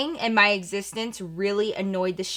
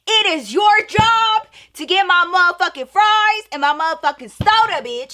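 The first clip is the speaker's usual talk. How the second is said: higher, louder, fired-up tirade; furious rant; 8 semitones higher, 6 dB louder than usual. Retold or imitated. Teen ranting at the cashier over fries and soda, loud and furious. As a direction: lay into her without holding back.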